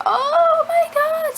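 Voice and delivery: drawn-out, very high gasp of shock. Retold or imitated. Spotting a tiny kitten curled in snow, slow high shock. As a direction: let it slide up high and slow in disbelief.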